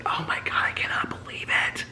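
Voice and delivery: whispered, re-enacted disbelief; voiceless, awestruck whisper; excited whisper. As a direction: gasp it out in a hushed whisper. Retelling excitement, whispered second take.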